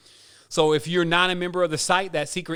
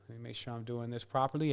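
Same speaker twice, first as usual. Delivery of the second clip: ordinary talk, nothing marked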